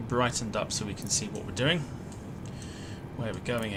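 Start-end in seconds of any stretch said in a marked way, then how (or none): none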